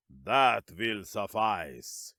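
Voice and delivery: slow, low commanding villain; calm but commanding. A narrator's reading. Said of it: "his tone calm but commanding".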